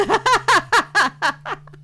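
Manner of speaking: big burst of laughter; loud laugh. Real, not acted. Laughing at a picture.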